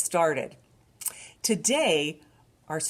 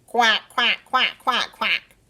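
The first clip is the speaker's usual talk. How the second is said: higher, louder quacking; duck quack; 5 semitones higher, 8 dB louder, slower than usual. Retold or imitated. Librarian quacking like a duck for babies. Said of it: "What does a duck say?".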